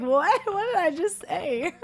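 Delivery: high-pitched mock disbelief; self-shocked. Visitor catching own borrowed accent, mock shock. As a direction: squeak it, amazed at yourself. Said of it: "I'm like".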